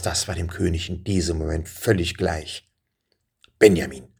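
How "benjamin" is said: low, loud, stern address; sharp king. A narrator's reading. King rebuking Benjamin, sharp and low. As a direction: say the name sharply, pitched down. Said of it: "sagte er scharf".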